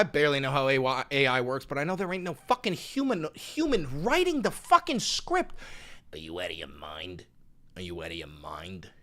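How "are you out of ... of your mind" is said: low, soft robotic drone; deadpan low repeat. Real, not acted. Streamer droning at a chatter in robotic monotone. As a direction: drone it flatly, over and over.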